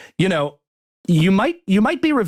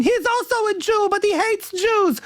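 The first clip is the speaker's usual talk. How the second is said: much higher, whiny mocking voice. Commentator mocks Israel's critics in a high, whiny voice. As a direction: squeak it out like a fretful caricature.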